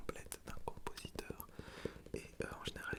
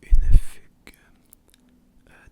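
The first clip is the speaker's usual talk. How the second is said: ordinary talk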